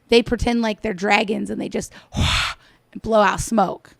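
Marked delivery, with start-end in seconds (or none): none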